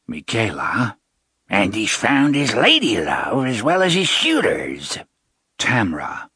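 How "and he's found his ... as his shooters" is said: put-on old witch voice. Sister taunts him.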